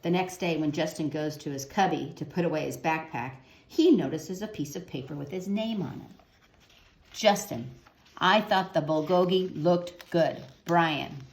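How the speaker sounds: put-on gentle storybook narration for kids; reading to children. A narrator's reading. Teacher reading a picture book to children, gentle storytelling. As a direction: read the picture book aloud to young listeners.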